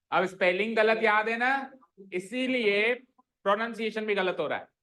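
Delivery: ordinary talk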